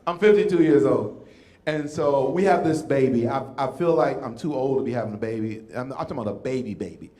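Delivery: ordinary talk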